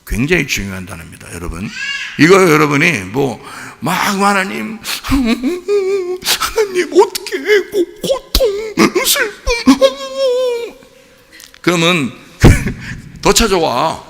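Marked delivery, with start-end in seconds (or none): 3.9-10.7 very high, drawn-out, hammed-up crying to God; mock sobbing. Preacher mocking a tearful complaining prayer, fake sobbing. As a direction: wail it like an overdone weepy prayer. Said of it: "진짜 슬프잖아".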